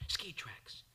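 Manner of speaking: breathless whisper in a trance. Acted. Man skiing downhill in a trance, whispered. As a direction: whisper it as if seeing a vision.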